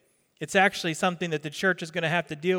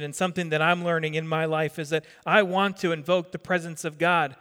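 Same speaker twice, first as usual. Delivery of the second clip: ordinary talk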